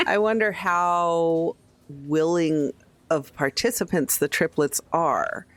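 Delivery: ordinary delivery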